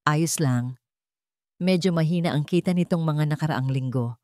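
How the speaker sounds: ordinary delivery, nothing marked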